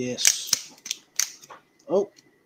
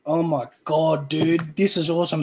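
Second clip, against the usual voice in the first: ordinary talk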